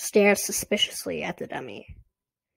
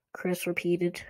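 ordinary delivery, nothing marked

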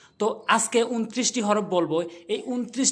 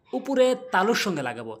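normal talking voice

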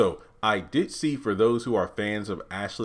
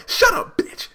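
very high, loud mock screech; shrill mock snap; 6 dB louder than usual. Jasmine silencing Andy, shrill. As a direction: snap at her.

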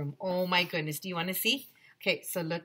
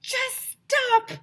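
very high, squealed cat plea; exasperated cat; 3 dB louder, much slower than usual. Acted. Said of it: "Cat is like".

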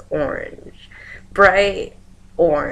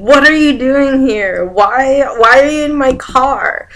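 high, loud acted outrage; retold yelling; 7 semitones higher, 8 dB louder than usual. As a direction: yell it at intruders, imitating the dream. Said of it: "I start yelling at them".

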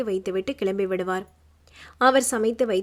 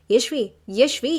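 loud, slow, drawn-out calling of the name; calling out loudly; 5 dB louder than usual. A narrator's reading. Brother calls his sister as the call drops, loud slow shouting. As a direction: call her name after her as she hangs up. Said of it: "என்று அவன் கத்திக் கொண்டிருக்கும் போதே".

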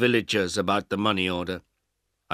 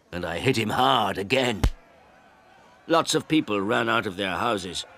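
ordinary delivery, nothing marked

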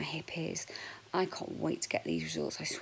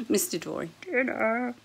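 calling out; 4 semitones higher, 4 dB louder than usual. Real, not acted. Calling the dog to dinner.

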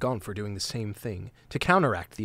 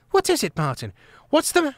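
higher, louder, cut off mid-word; alarmed question; 5 dB louder than usual. Acted. Trimp waking startled to Martin, alarmed and cut off. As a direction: ask in sudden alarm and get hushed.

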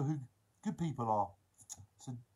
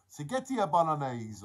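louder mock-Italian accent; 5 dB louder than usual. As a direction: savour it in a playful Italian accent. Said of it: "we all have an Italian accent".

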